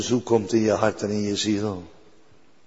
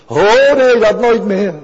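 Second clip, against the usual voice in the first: high, loud fervent denial; emphatic cry; 9 semitones higher, 8 dB louder than usual. Real, not acted. Preacher insisting to congregation he won't doubt God, loud fervent.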